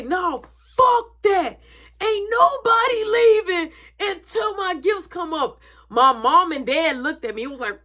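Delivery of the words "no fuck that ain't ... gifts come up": high, slow crying demand; crying tantrum. Retold or imitated. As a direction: wail it like a spoiled birthday kid. Kid tantrum over missing gifts.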